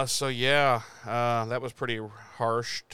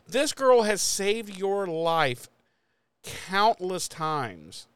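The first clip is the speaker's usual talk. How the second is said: higher, slow exasperated protest, dragged out; mock outrage; 7 semitones higher, much slower than usual. Fan reacting to Cordy's insult, slow mock outrage. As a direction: act aghast, stretching each word. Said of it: "And I was like".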